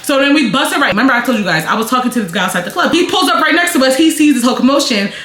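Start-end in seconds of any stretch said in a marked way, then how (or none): none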